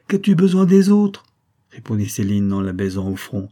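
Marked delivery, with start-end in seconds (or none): 0.1-1.2 high, loud, emphatic question; fond protest. A narrator's reading. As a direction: insist she needs nobody else. Nurse reassures girl, kisses her. (protestation affectueuse et vive)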